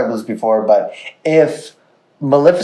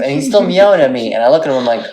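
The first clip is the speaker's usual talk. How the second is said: normal talking voice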